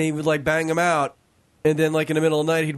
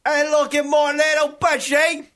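high, shouted mock English; loud English accent; 12 semitones higher, 4 dB louder than usual. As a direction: yell it in a silly English accent. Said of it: "Why do they get so loud".

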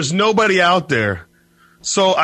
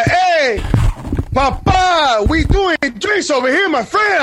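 put-on Puerto Rican accent; 10 semitones higher, slower than usual. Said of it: "doing the Puerto Rican accent".

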